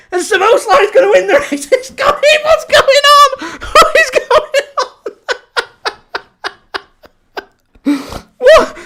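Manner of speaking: very high, shrieking laugh-shout; hysterical laughter. Real, not acted. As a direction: cackle in total disbelief. Chaos as late goal happens.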